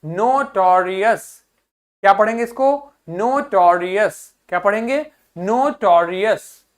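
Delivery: notorious drawn out slowly, each syllable clear; slow model pronunciation. Shown as a demonstration. Teacher models नोटोरियस slowly for students. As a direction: say नोटोरियस slowly for the class to repeat. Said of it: "क्या हो जाएगा".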